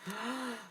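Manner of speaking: soft, breathed gasp of delight; admiring gasp. Real, not acted. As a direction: draw in a quiet gasp of admiration. Gasps at the card bases.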